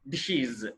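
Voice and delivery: డిషీస్ as "dish-iz"; "-iz" ending. Shown as a demonstration. Teacher correcting learners' plural, డిషీస్ as "dish-iz". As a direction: end డిషీస్ on an i and buzzed z. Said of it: "ఇజ్".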